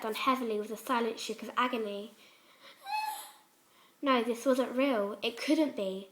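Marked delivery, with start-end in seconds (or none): none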